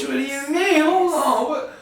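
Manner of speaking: stunned by what he just heard; son-in-law shocked by his mother-in-law. Acted.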